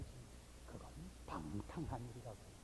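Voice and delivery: usual speaking voice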